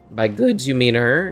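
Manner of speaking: usual speaking voice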